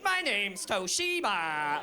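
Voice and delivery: slow, flat mock robot daughter. Daughter impersonating a robot, slow mock robot. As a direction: drag it out like a broken gadget.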